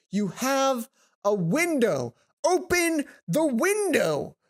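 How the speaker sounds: slow, loud, rising exasperation; exasperated yell. Real, not acted. Player yelling at the character to open the window, loud. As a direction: yell it at the screen.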